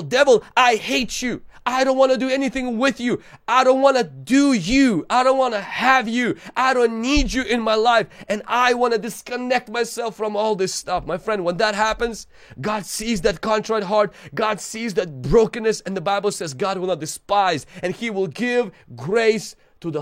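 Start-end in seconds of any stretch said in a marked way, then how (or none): 0.0-11.1 loud, high defiant cry at the devil; a believer renouncing the devil, loud and defiant; defiant rebuke. Pretend. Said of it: "you're telling devil". 11.1-17.6 high, loud, urgent sermon climax; fiery revival preacher building to a shout; preaching at full force. Acted.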